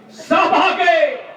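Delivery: sermon peak, slow and lifted; slow high proclamation. Real, not acted. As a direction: stretch each word, pitched high. Climax after the list of prophets.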